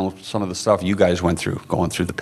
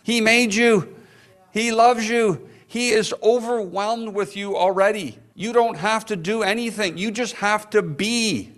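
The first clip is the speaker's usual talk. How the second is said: impassioned preaching; 8 semitones higher than usual. Acted. Preacher pleading that the Father loves them.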